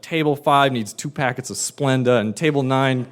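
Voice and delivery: ordinary delivery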